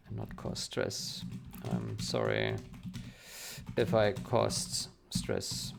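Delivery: slow, halting dictation voice, word by word; dictating. Real, not acted. Developer dictating a reply to a reviewer, slow and halting. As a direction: spell out a reply to a speech-to-text app, pausing often.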